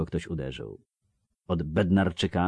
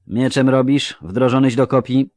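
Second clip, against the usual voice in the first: higher, louder clipped-off questions of a bored officer; brusque recruiter; 7 semitones higher, 11 dB louder than usual. Acted. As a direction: bark the questions like a tired recruiter. (znudzony komisarz, głośno i wyżej)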